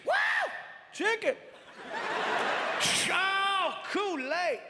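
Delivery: delighted, rising to a high squeal; excited. Retold or imitated. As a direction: friend opening the gift basket, thrilled. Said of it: "getting excited over every single item".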